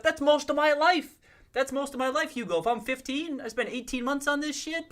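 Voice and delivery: high-pitched mock disbelief; playful, high-voiced indignation; mock outrage. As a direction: protest it way up high, like an exasperated teen. Mock outrage as imagined teenager.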